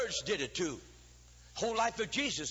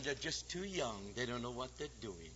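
sly devil; 8 semitones lower, 9 dB quieter than usual. Acted. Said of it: "He'll tell mother and dad".

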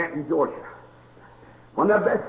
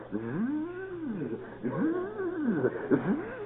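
buzzing bee; 10 semitones higher, 8 dB quieter than usual. Retold or imitated.

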